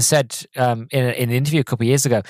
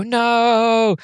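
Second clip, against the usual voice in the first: high mock cry of no as he falls; a hammed-up falling scream; high, drawn-out cartoon no; falling porter's cry; 11 semitones higher, 3 dB louder than usual.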